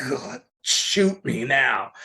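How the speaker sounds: slow, weary exasperation; exasperated. Retold or imitated. Publisher hears another awful title pitch, exasperated. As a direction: drag it out in mock despair.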